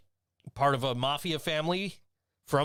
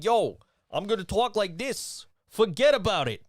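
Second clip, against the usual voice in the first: put-on New York accent; 6 semitones higher, 5 dB louder than usual. Upstate New Yorker mocking the city, hammed-up New York accent. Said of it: "part of New York that's like".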